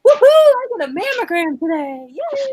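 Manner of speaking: high, bright sarcastic whoop; hammed-up fake delight; mock excitement. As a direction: cheer it like it's the best day ever. Mock cheer about getting mammogram.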